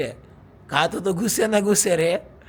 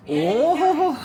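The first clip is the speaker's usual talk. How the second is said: amused squeal of a laugh; high delighted laugh; 13 semitones higher, 6 dB louder, much slower than usual. Real, not acted. Husband laughing at his wife's comeback, high laugh.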